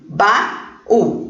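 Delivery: "baú" split in two, ba-ú, with a gap; syllable by syllable. Shown as a demonstration. Teacher counting syllables for pupils, "baú" broken into ba-ú. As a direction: separate both syllables of "baú". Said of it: "duas sílabas".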